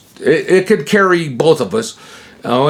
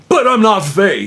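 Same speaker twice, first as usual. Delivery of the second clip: high-pitched, insistent objection; emphatic retold refusal; 8 semitones higher than usual. Teller retelling how he turned down the offer, insistent. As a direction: push back, pitched well up.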